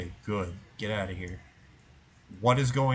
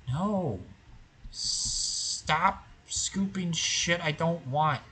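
exasperated; 6 semitones higher, much slower than usual. Real, not acted. Frustrated at game scoop.

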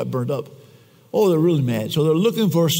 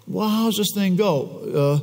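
ordinary talk

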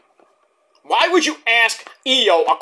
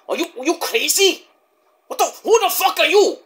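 higher-pitched, put-on disbelief; imitated incredulous retort; 8 semitones higher than usual. Io answering the scrum questioner, imitated incredulity. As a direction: act out a baffled comeback, pitch jumping up. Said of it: "Io's like".

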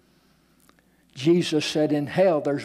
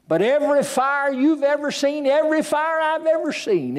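rising preaching; 11 semitones higher than usual. Acted. Preacher warning the congregation about hell fire, rising preaching. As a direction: build the fervour line by line.

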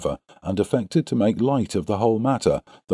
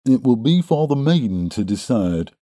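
higher, smug character voice; smirking young Drebber; 5 semitones higher than usual. A narrator's reading. Suitor admiring himself answers his rival, smirking. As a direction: rejoin with a smirk in the voice. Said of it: "rejoined young Drebber, smirking at his own reflection".

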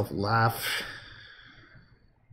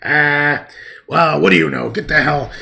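put-on loud, cranky old-man grumble; grumpy old man griping; 9 dB louder than usual. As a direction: complain like a grouch. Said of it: "just grumpy and always complaining".

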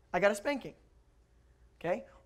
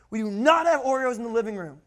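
louder, higher mock scolding; scolding mother; 4 semitones higher, 6 dB louder than usual. Mother spanks child over spilled Oreos, scolding voice. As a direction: scold hard, hitting every word.

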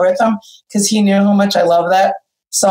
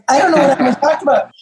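rushed and higher, in a nervous tumble; panicked; 6 semitones higher, faster than usual. Retold or imitated. Telling Lydia on the phone she has nothing to say, panicked and fast. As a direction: blurt it out fast, flustered and scared. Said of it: "I was so freaked out".

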